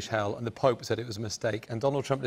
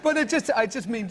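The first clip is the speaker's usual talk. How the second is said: laughs it off, higher, caught out; a sheepish real laugh through the words; 11 semitones higher, slower than usual. Real, not acted. Caught out by the host's tease, laughing. Host teases her choice of politicians.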